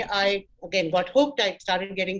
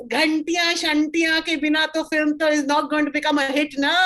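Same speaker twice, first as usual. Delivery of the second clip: higher-pitched Punjabi English accent; crass Punjabi English; 6 semitones higher, 3 dB louder than usual. Shown as a demonstration. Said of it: "That kind of English".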